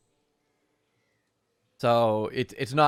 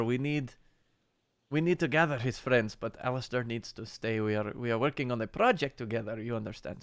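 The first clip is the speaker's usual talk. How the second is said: put-on Russian accent. Priest asking Milivoj a favour, light Russian accent. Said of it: "I'm getting Russian".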